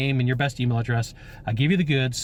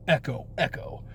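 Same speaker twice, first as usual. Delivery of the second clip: softer, fading echo effect; mock echo; 5 dB quieter than usual. Shown as a demonstration. Salesman adds a playful fading echo, softer. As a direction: echo the words away into the distance. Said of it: "And with echo".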